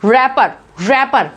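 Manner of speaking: rapper with no W sound; R-apper, W not voiced; silent W. Shown as a demonstration. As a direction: say rapper starting on a plain R. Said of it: "we pronounce it as".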